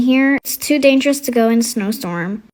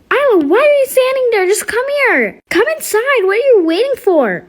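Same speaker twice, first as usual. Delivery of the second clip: high-pitched mom voice, faster, calling her daughter inside; impatient mom shouting from the doorway, pitched higher; 9 semitones higher than usual. Acted.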